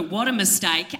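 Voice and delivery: ordinary delivery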